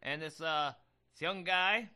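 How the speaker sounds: ordinary talk, nothing marked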